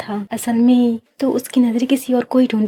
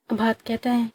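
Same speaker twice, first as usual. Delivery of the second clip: plain reading voice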